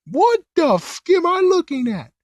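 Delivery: very high startled falsetto; high falsetto shock. Retold or imitated. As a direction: squeal it out in disbelief. Shocked reaction voiced in recap.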